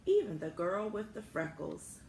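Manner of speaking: ordinary delivery, nothing marked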